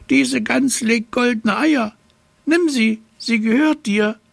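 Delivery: very high-pitched little gnome; high fairy-tale gnome voice; little man. A narrator's reading. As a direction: squeak it as a tiny gift-giving man. Figure of the little man gives goose.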